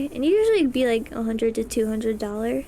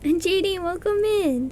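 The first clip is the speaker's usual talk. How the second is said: higher cheerful welcome; excited greeting; 6 semitones higher than usual. Real, not acted. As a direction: welcome them warmly. Greeting a stream viewer.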